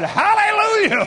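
high, loud retold hallelujah holler; whooping preacher's holler. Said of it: "I just hollered".

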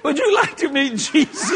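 high, laughing question; laughing punchline. Acted. The preacher offers the choked dealer Jesus, laughing. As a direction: ask it while cracking up.

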